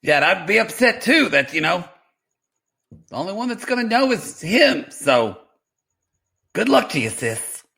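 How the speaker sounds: Darcy answering her sister; playing Darcy. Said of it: "playing the role of Darcy".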